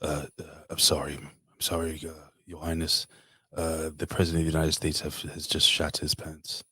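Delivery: a hint of a stammering, apologetic aide; nervous aide. Aide apologizing to the Queen, lightly voiced and hesitant. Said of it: "some dude's like".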